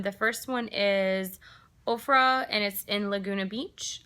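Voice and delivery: ordinary talk, nothing marked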